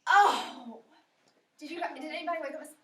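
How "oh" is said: loud drawn-out groan; a long loud moaning "Oh"; rainy-morning moan. Retold or imitated. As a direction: groan like you hate the rain. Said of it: "you were like".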